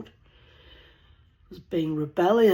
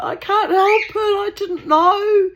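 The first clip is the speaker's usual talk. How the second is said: much higher, louder, slow mock-crying whine; whiny Pedro; 11 dB louder than usual. Pedro defending his father's brandy, high mock-crying whine. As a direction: whimper the excuse like a scolded boy. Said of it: "Pedro would be like".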